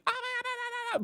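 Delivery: very high, soft cackling ahs; annoying anime laugh. Retold or imitated. Mocking Japanese dubbing's goofy old character noises, very high. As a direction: squeak it like a silly anime elder. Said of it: "that annoying".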